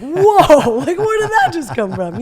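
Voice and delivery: loud, high mock astonishment; hammed-up whoa through laughter; shocked reaction. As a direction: react in comic alarm. People's shocked reaction, acted.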